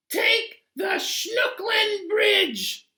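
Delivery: high, loud cartoon passenger, slow and sure; odd passenger voice. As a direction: squeak it out like a goofy know-it-all.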